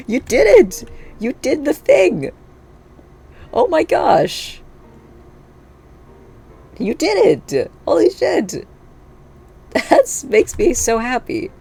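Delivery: delighted high-pitched cheering at the robot; thrilled squeal. Real, not acted. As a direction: squeal with real delight as it finally works. Robot's first test run works.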